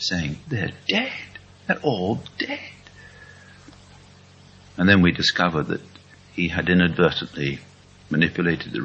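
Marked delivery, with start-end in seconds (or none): 0.5-2.6 broken-hearted. Retold or imitated. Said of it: "absolutely broken-hearted".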